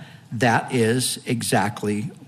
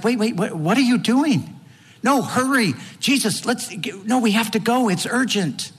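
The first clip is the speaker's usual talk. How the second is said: higher, rushed pleading to hurry; a frantic father begging Jesus to hurry; panicked Jairus; 8 semitones higher than usual. Retold or imitated. Jairus begging Jesus to hurry, frantic and raised. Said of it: "Jairus is like".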